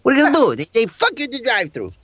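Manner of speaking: Joe Pesci Batman. Pretend. Batman rants at the Joker as Pesci in Goodfellas. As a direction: fire it off like an agitated wiseguy, pitched high. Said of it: "Pesci in Goodfellas as the Batman".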